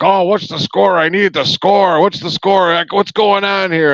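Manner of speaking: frantic Danny. Retold or imitated. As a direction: play the anxious fan begging for the score. Danny voiced demanding the score.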